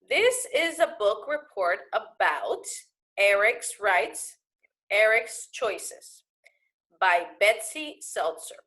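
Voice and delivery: slow, careful read-aloud. Shown as a demonstration. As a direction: read each word slowly for the class. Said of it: "so let's read".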